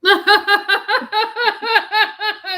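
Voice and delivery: loud high-pitched laughter; big laugh. Real, not acted. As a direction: burst out laughing loudly. Hearty laugh at friend's joke.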